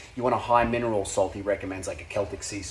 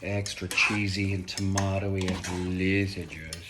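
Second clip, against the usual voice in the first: slow savouring; slower than usual. Pretend. Savouring description slowly.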